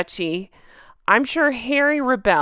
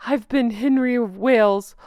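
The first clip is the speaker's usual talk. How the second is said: whiny Harry voice; 3 dB louder than usual. Retold or imitated. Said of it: "I can imagine him arguing".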